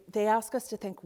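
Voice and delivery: ordinary delivery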